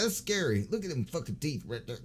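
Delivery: usual speaking voice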